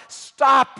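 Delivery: sharp high shouted command; yell. Retold or imitated. Jesus shouting at Peter mid-swing to stop, loud yell. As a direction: shout him to a halt. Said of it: "I heard Jesus yell".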